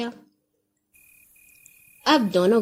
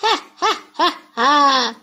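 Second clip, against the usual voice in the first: mocking guffaw; 3 semitones higher than usual. A narrator's reading. Moneylender laughs at Raja's boast, mocking guffaw. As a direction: laugh the Raja to scorn. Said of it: "ساہوکار نے قہقہا لگایا".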